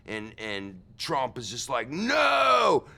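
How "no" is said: mock yell. Trump refuses to leave.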